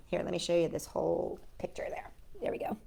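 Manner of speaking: soft whisper to the viewer; hushed aside while showing the page. Real, not acted. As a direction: whisper as you turn the book. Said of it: "aside to viewer while showing picture".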